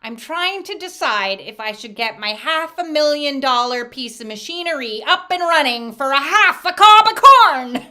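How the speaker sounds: acted wife's comeback rising to a shout; punchline swelling into a holler; building exasperated retort. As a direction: start firm, end high and very loud.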